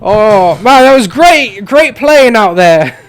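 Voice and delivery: retake the line as an Australian coach; Australian accent, second attempt. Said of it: "I can't do Australian".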